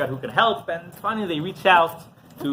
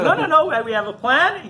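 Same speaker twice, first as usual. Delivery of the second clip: high, animated acted protest; hasty reassurance; 12 semitones higher, 4 dB louder than usual. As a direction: wave off objections, amused. Friend hurries to calm protesters.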